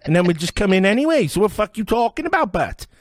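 high, loud Glasgow street thug; glasgow street accent. Retold or imitated. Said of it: "And they're going".

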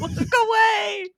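high, slow, laughing yelp; laughing plea. Real, not acted. Co-host begging the other to look away, laughing. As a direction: beg them to look away through laughter. Hiding face from camera, laughing.

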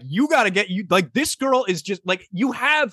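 high-pitched pep talk from a buddy; imitating pushy, excited friends; hyping up. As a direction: urge them on like a huddle pep talk. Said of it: "are hyping you up".

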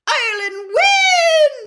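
very high, very loud, long-held final word; commentator's stretched victory yell; triumphant shout. A narrator's reading. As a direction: shout the win to the whole stadium. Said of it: "shouted Bagman".

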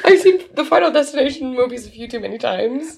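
high, loud, laughing mock terror; mock panic. Telling the chiropractor she's scared, in hammed-up panic. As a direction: squeak out a hammed-up fear of dying. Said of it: "I'm like".

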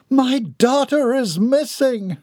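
very high, anguished mother's voice; distraught. A narrator's reading. Mother reminding the group her daughter is missing, distraught. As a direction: plead in a high, drawn-out lament.

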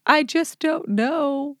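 put-on high, slow, teary little-girl whine; imitating teary child. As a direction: mimic a five-year-old crying helplessly. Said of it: "with tears, like, streaming down her face".

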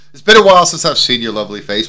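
ordinary talk

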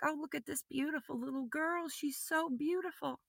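high, soft voice full of wonder; hushed, high adoring voice; tender cooing. Retold or imitated. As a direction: coo softly over a baby. Cooing over a newborn in dream.

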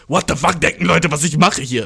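retold disbelief, voiced up; indignant protest. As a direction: push the protest out harder. Same protest again, now raised.

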